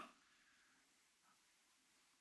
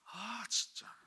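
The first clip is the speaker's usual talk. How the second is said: whispered grumble; 10 semitones higher, 4 dB quieter, much slower than usual. Retold or imitated. Child grumbling reluctantly.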